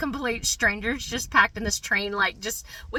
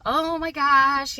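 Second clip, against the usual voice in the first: loud, high, drawn-out panic; mock panic; 6 semitones higher, 9 dB louder, slower than usual. Mom recalling panic in a packed train, mock alarm. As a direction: stretch it out in alarmed dismay, higher and louder. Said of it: "saw the panic in my eyes".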